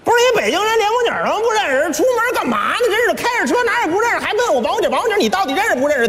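high, rapid Beijing-dialect scolding; Beijing accent. Retold or imitated. Beijing sat-nav scolds driver who is lost, exasperated Beijing accent. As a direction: berate the driver in fast Beijing speech. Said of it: "北京话导航一开".

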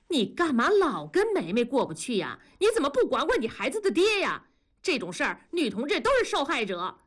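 high-pitched remonstrating; indignant. A narrator's reading. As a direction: argue back to shield your friend. Acted quarrel, defending a friend. (高声替朋友争辩)

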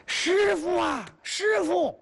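high shrill panicked cries for master; panicked calling. Acted. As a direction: call out in a frantic high voice. 徒弟慌张跑进来喊. (尖声慌张地喊师傅)

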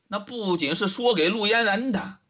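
plain reading voice

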